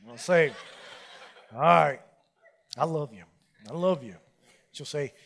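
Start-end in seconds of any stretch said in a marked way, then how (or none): 1.5-4.2 grudging mumble. Retold or imitated.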